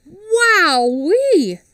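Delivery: high, loud gasp of wonder; wide-eyed childlike wowee; amazed. Acted. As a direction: squeal it like a thrilled kid. Carl amazed by mechanical man.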